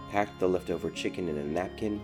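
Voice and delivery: usual speaking voice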